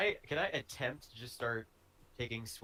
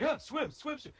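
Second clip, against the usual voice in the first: frantic swimming cry. Pretend. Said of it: "so I'm just like".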